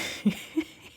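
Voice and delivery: short, high giggle. Real, not acted. Host laughs at herself for fangirling, giggling. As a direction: let out a little excited laugh.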